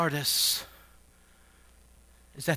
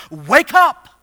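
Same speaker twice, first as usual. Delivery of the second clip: high, loud shouted command; forceful raised-voice order; emphatic imperative; 9 semitones higher, 15 dB louder than usual. Acted. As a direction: call it out to rouse sleepers. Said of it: "It's meant to be emphatic".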